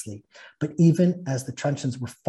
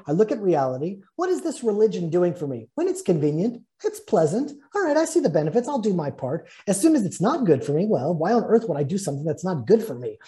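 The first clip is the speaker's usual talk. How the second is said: higher, animated mock objections; self-interested objector; 5 semitones higher than usual. Preacher voicing someone weighing religion by convenience, mock self-interested. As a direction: play the questioning skeptic, pitched up and insistent.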